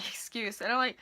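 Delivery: ordinary delivery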